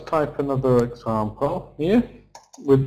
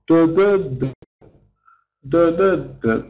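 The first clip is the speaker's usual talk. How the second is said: "Duh, duh, duh" with the middle beat strong; small-big-small stress pattern; 3 semitones higher than usual. Shown as a demonstration. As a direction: chant the rhythm weak, STRONG, weak on "Duh". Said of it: "this stress pattern is saying small, big, small".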